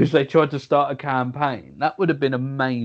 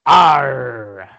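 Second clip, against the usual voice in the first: pirate voice; 8 dB louder than usual. Pretend. Pirate's favourite letter joke.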